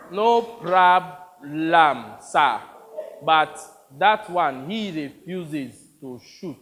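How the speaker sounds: put-on slow, halting African accent; exaggerated African accent. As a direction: force out a thick African accent, word by word. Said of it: "Read the lines like Toby".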